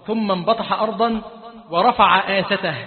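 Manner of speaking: ordinary talk, nothing marked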